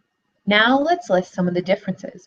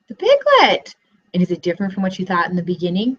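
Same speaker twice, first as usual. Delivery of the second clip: ordinary delivery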